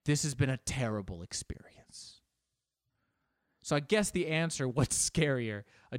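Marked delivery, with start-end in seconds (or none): 0.1-2.2 hushed, breathy aside to the listener; whispered aside. Real, not acted. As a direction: confide it under your breath.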